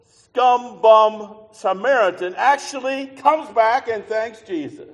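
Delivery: loud, high, slow preaching; full-force preaching. Acted. Preacher marvels loudly at the Samaritan's return. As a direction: drive it home to the congregation.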